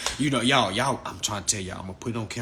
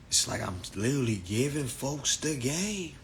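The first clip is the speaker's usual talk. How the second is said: ordinary delivery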